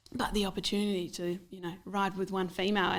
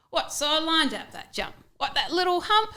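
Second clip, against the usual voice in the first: incredulous; 9 semitones higher, 5 dB louder than usual. Retold or imitated. Incredulous quote in poem.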